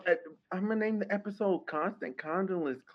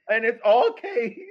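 laughing; 8 dB louder, slower than usual. Real, not acted. Laughing at the name.

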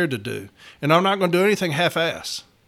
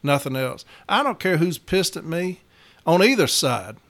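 ordinary talk

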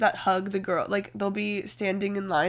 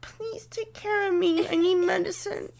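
mock sick whine; 9 semitones higher than usual. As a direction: beg like a sick child. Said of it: "I'm sick".

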